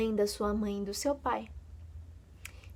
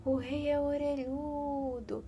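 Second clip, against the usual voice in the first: soft, slow, higher faraway voice; distant windy echo; 4 semitones higher, 8 dB quieter than usual. Acted. Villagers hearing the tree's distant whisper, soft and slow. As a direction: let it drift in like a far-off rumour. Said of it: "ouvia aquilo".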